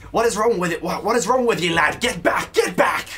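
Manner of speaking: loud gruff yelling; angry old sailor shout. Acted. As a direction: bark at the lad to get back. Theron yells at lad.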